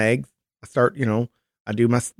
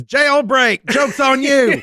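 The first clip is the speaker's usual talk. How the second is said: voice of the candy; 12 semitones higher, much slower than usual. Acted. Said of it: "it says".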